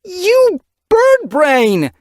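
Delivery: slow, loud, high furious scolding; hot with rage. Acted. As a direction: spit out the insult in rage. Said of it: "her mew hot with rage".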